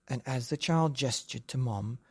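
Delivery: usual speaking voice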